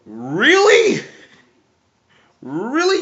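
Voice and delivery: high, drawn-out disbelieving Really; can't believe what he just read; incredulous. Real, not acted. Vlogger reacts to the editor's joke, incredulous.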